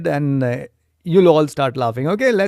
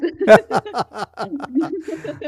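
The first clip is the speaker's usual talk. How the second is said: high laugh; 9 semitones higher than usual. Real, not acted. Laughing at the secret.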